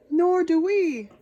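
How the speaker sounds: loud, high slow chorus. Acted. Knights agreeing together with the dragon, loud chorus. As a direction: say it all together. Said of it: "chorused the knights".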